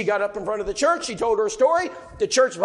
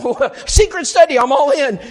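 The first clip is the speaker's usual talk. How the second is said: eager laugh; 6 semitones higher, 9 dB louder than usual. Retold or imitated. Preacher eager for a secret study.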